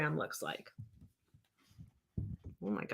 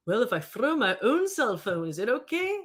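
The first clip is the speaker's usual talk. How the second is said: higher-pitched, hammed-up attempt at Scottish; bad Scottish accent; 4 semitones higher, 6 dB louder than usual. Host joking as a viewer who threw their phone, badly faked Scottish accent. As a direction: put on an awful Scottish accent, rising at the end. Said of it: "I can't do the Scottish accent very well".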